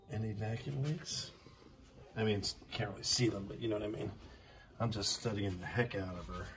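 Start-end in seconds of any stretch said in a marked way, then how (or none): none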